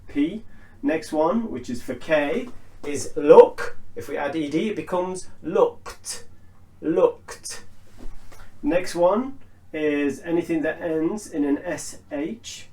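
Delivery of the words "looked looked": "looked" ending in a voiceless t. Shown as a demonstration. Teacher modelling verbs ending in K, T ending. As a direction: say "looked" as one syllable, "lookt". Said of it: "a T sound".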